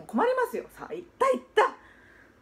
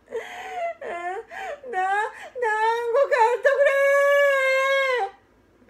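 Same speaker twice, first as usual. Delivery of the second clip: put-on high, loud, drawn-out wail of a little boy; boy sobbing loudly for dumplings in the street; bawling child; 12 semitones higher, 6 dB louder, much slower than usual. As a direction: howl the plea like a kid throwing a tantrum. Child bursts into loud crying.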